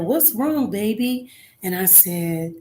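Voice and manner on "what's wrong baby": higher, gently worried dad; concerned father. Retold or imitated. Father asking his crying daughter in the car, tender and higher. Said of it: "And he said".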